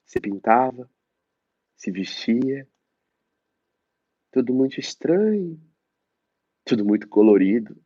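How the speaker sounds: theatrical, mournful recital; dramatic monologue. Acted. Actor reciting the character's childhood memory, mournful. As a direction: perform the memory with longing.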